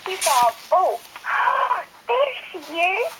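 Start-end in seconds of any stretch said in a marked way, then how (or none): 1.0-1.8 gasp. Acted. Baby bird spots a boat. 2.1-3.1 put-on high, slow hopeful baby bird. Baby bird spotting a boat, high hopeful voice. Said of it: "said the baby bird".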